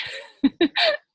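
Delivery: high loud laugh at her slip; real high laugh; bright laugh. Real, not acted. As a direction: laugh off the mistake.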